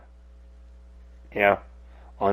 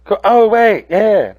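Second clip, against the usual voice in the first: mock shopkeeper; 8 semitones higher, 5 dB louder, slower than usual. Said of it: "And she'll be like".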